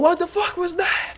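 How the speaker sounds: high, startled yell from below; dad yelling. Retold or imitated. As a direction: bark it out like an angry dad.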